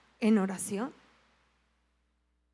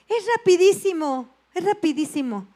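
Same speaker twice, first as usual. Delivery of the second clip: higher, louder, brisk and pushy; insistent nurse; 6 semitones higher, 6 dB louder than usual. Retold or imitated. Nurse brushing off the patient's worry, higher and louder. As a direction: reassure her it's over in a second.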